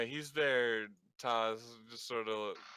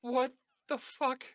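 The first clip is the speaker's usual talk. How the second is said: shocked Declan; 11 dB louder than usual. Acted. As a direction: squeak out shocked disbelief. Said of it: "Declan looks around at all of you and goes".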